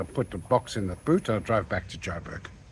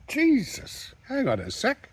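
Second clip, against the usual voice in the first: much higher startled yelp, then a plea; 13 semitones higher, slower than usual. Retold or imitated. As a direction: caught off guard as the toaster flies. Toaster thrown at him.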